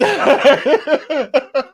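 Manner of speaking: high, giddy laughter. Real, not acted. Dad laughing at how he melts, giddy. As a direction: laugh at your own softness. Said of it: "It's so nuts".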